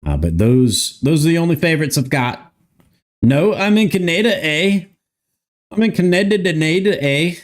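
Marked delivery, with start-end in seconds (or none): 3.2-7.3 mock Canadian accent. Streamer teasing Canadian viewer, high mock Canadian accent.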